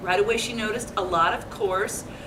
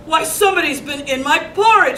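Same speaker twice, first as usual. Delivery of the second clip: louder, gruff-ish big bear voice; a lightly voiced big papa bear, indignant; 5 semitones higher, 6 dB louder, faster than usual. A narrator's reading. Reading to kids, Papa Bear finds his porridge eaten, indignant. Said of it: "said Papa Bear".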